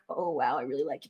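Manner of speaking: low, soft mock realisation; quiet admission. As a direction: drop low and quiet, as if won over. Acting out being won over.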